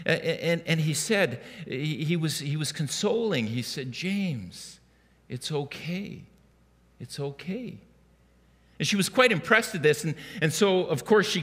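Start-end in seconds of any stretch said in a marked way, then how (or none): 3.9-4.8 gentle soothing. Retold or imitated. Father soothing fussy toddler in store. 5.3-7.8 soft reassurance. Retold or imitated. Father soothing fussy toddler in store.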